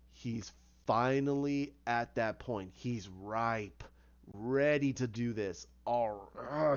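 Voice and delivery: slow, low relish; lightly acted dark anticipation; imitated savouring villain. Joker savours Batman being ready.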